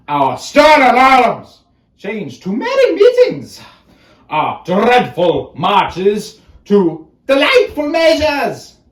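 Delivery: high, loud theatrical proclamation; mock-triumphant declaiming. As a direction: ring out the key words like a grand announcement. Mock-triumphant opening of soliloquy.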